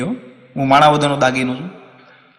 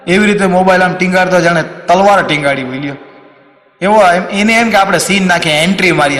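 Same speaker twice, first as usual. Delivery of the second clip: ordinary talk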